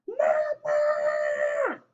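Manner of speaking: put-on high, drawn-out child's call. A narrator's reading. Baby llama calls down to his mama, calling out. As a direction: holler downstairs for mama, high and long. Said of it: "calls down to his llama mama".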